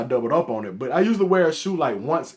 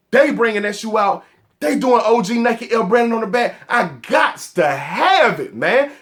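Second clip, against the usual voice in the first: higher-pitched excited retelling; hyped recollection; 7 semitones higher, 4 dB louder than usual. Retold or imitated. Sneaker fan retelling his reaction to release news, hyped. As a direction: re-enact being hyped, stretching the last phrase.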